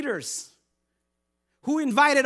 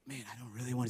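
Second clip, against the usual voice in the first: half-swallowed reluctant grumble, much softer; reluctant mumble; 11 semitones lower, 13 dB quieter, faster than usual. Pretend. Imagined guest dodging invitation.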